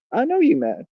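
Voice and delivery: I know you mad jumps high in mock taunt. Shown as a demonstration. Streamer taunting chat, high mocking taunt. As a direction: sing it up high to needle them.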